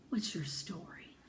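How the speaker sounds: hushed question to a photo; Mama musing to the photograph; soft whisper. A narrator's reading. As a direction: whisper to the woman in the picture. Mama asks photo softly.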